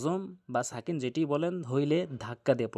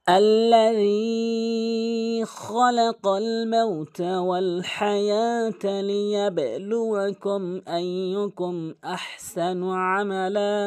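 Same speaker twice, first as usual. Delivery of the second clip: slow, higher, drawn-out tajweed chant; Quran recitation; 6 semitones higher, 4 dB louder, much slower than usual. Shown as a demonstration. Teacher recites the verse for learners, measured Quran recitation. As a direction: recite the verse with long held vowels.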